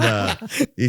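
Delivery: normal talking voice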